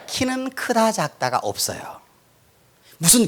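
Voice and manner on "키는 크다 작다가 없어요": hushed. Real, not acted. Drops voice for point.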